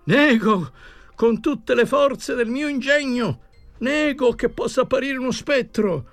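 high-pitched, sustained proclamation of denial; frantic crying out. A narrator's reading. Professor denies that spectres can exist, frantic high cry. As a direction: declaim it high, with all your strength. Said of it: "gridò ancora".

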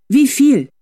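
high, loud firm demand. A narrator's reading. Felicitas pressing the dumbfounded driver, firm demand. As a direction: press him for a price. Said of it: "mit fester Stimme".

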